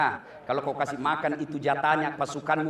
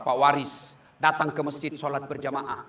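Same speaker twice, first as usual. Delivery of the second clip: ordinary talk, nothing marked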